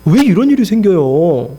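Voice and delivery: imitating an aggrieved protest; resentful complaint. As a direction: plead and grumble, dragging the last syllables. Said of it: "또다시 원망하는 거예요".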